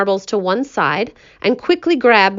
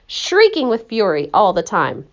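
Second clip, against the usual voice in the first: ordinary delivery, nothing marked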